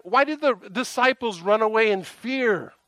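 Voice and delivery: high, exasperated question; frustrated. Retold or imitated. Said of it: "I was frustrated".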